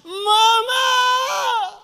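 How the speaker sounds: very high, long-held wail; imitated child's cry. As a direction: scream out like a little girl calling for her mother. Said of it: "little girl lift up her voice".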